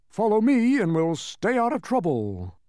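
high-pitched character voice; self-important humbug. Acted. Humbug brushing himself off, high and self-important.

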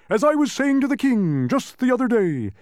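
high, loud boastful gentleman; name-drop grandly; pompous humbug. A narrator's reading.

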